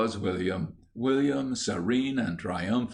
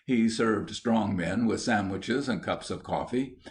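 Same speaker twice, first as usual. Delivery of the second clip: ordinary delivery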